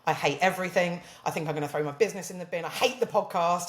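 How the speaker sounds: ordinary talk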